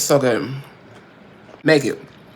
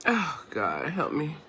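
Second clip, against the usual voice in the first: weary plea; 12 dB quieter, slower than usual. Real, not acted. Exasperated muttered plea.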